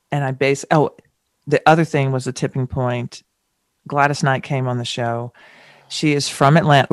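ordinary talk

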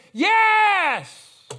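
very high, very loud drawn-out yell; crowd's scream. Retold or imitated. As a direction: scream it back like a whole cheering crowd. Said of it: "all in unison scream back at him".

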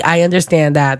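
ordinary talk

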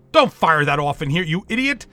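loud, angry holler. Acted. Doctor hollering at the security guard, loud and angry. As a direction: yell at the guard to hold fire. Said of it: "the doctor hollered".

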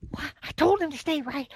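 high, puzzled self-talk; baffled inner voice. Retold or imitated. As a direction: replay the confusion, half-hushed. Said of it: "And I'm like".

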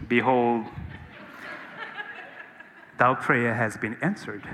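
very low, slow divine proclamation; voice of God. Acted. Preacher parodying how people think God answers prayer, deep voice. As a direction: intone it deep and solemn, like a voice from above. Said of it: "he heard a voice going".

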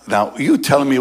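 usual speaking voice

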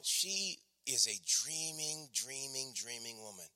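fading repetition. Real, not acted. Repeating dreaming, fading away.

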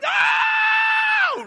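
desperate shout. Retold or imitated. Parent screaming at kid running to road, full yell. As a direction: scream to stop a child. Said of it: "you run and you're like".

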